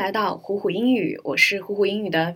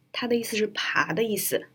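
ordinary talk

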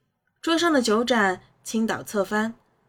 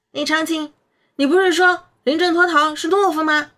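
put-on higher, louder cheeky child; a boy questioning, raised voice; 6 semitones higher, 7 dB louder than usual. A narrator's reading. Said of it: "我问".

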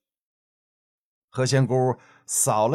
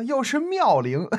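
leering laugh; 11 semitones higher than usual. Acted. Playboy laughs mid-flattery.

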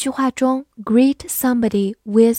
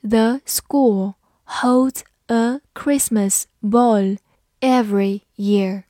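slow, careful word-by-word reading; slower than usual. Shown as a demonstration. Teacher reading slowly for students to repeat. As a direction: read it slowly for learners to follow. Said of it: "好，慢读一遍".

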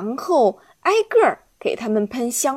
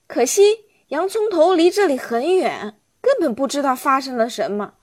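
ordinary delivery

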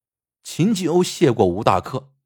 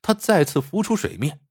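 ordinary delivery

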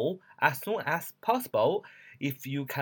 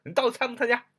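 pushy, higher-pitched demand for an answer; very impolite demand; 8 semitones higher, faster than usual. Acted. Teacher acts out a blunt invitation without would or please, very impolite. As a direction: demand it rudely, pitched up high. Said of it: "非常的不客气啊！".

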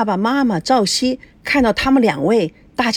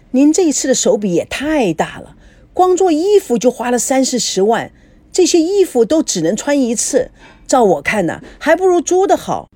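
higher-pitched, fussing mother-in-law; disapproving in-law; 6 semitones higher, 4 dB louder than usual. Acted. In-law commenting on costly wedding clothes, high and disapproving. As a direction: exclaim over the wasteful spending.

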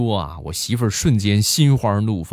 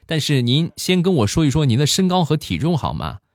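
higher-pitched customer-service imitation; put-on polite shopkeeper voice, high; online shop seller; 7 semitones higher than usual. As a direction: play an obliging seller, pitched up. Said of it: "这个商家就说".

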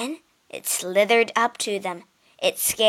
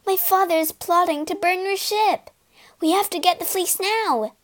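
urgent warning; 9 semitones higher than usual. Acted. Medea warns Jason urgently.

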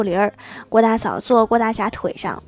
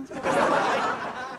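soft unvoiced chuckle; breathy laugh; 7 dB quieter than usual. Real, not acted. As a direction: let out a quiet breathy laugh. Laugh after punchline.

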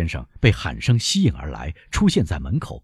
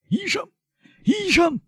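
very high, strained call for a doctor; panicked high-pitched summons; urgent shout. A narrator's reading. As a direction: cry out in alarm for help. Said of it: "他喊道".

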